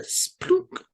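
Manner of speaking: a soft voiceless splunk, like something dropped in water; imitated splash. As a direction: mimic the plop of an object hitting water. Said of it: "that one little sound you heard".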